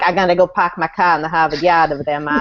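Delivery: hammed-up Boston accent with dropped Rs; mock Boston accent for a stock phrase. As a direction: put on a broad Boston accent. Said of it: "didn't have a little Boston in".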